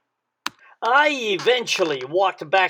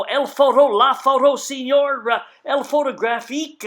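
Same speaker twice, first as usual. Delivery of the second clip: mumbled broken Spanish; 4 semitones higher than usual. Retold or imitated. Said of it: "I was motioning and mumbling".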